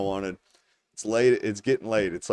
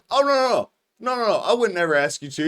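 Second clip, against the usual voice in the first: high-pitched, quick backpedalling; mock-innocent protest in a higher, louder voice; imitated hasty denial; 9 semitones higher, 4 dB louder, faster than usual. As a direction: rush out the protest, pitched well up. Friend hastily denying, retold.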